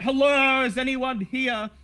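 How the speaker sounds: loud, high call into the shop; shout. Acted. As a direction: call out to anyone in the back. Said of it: "You shout out".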